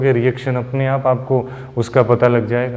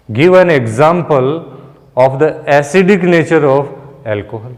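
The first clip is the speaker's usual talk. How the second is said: dictating an exam question; much slower than usual. Shown as a demonstration. Teacher reading an exam question aloud slowly for students. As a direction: read it out slowly like a question paper. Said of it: "यहीं से कुछ questions मैं बना रहा हूं".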